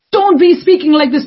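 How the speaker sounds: put-on high, loud scolding of a child; nagging parent. Parent scolding the kids, high and loud. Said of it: "Then they'll go after their kids".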